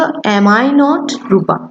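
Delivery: very slow, each word stretched; slow demo. Shown as a demonstration. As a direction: model the question word by word. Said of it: "হওয়ার কথা ছিল".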